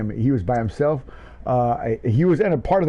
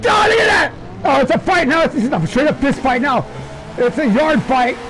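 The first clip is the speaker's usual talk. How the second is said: frenzied race play-by-play; 13 semitones higher than usual. Real, not acted. Commentator calling a last-lap fight to the line, frenzied shouting. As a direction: scream the finish as it happens, voice soaring.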